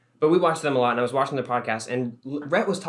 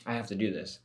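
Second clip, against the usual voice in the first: muttered inner dread; 3 semitones lower, 11 dB quieter than usual. Retold or imitated. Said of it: "I'm thinking to myself".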